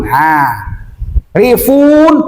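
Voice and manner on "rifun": rifun with the fun held long; long vowel. Shown as a demonstration. Teacher repeats the ending to show lengthening, rifun held long. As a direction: draw out the u in rifun.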